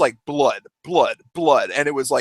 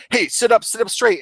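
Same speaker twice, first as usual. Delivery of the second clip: higher, brisk imitated command; officer's order; 6 semitones higher than usual. Police officer to slumped injured man, imitated order. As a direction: give an urgent order, lightly acted.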